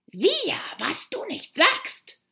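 trembling mouse shrieking, high and loud; indignant cry. A narrator's reading. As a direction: shriek in offended disbelief. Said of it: "schrie die Maus". (empörter, hoher Aufschrei der Maus)

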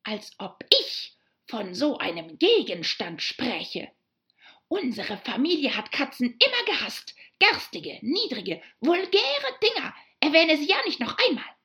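indignant mouse rant, climbing high and loud; outraged cry. A narrator's reading. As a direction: build from offended scorn to a shrill, fast command. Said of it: "schrie die Maus, die bis in die Schwanzspitze zitterte". (empörte, immer lauter schimpfende Maus)

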